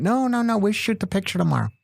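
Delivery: very high, dismissive producer impression; Bob Wellborn. Producer brushing off the actor's wardrobe question, high impression. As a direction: wave the question away in a high put-on voice. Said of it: "He talks like this".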